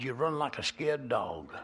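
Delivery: ordinary delivery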